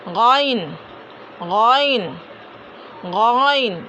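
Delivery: गैन with a throaty gh and rounded, held vowel; full-mouth ghain. Shown as a demonstration. Teacher modelling a heavy letter, full pronunciation. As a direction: fill the mouth on a slow गैन. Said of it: "ये भी मुंह भर के अदा होगा".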